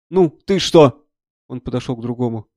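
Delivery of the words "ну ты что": loud and higher, demanding; brusque doctor's question. Acted. Army doctor to the next wounded man, loudly brusque.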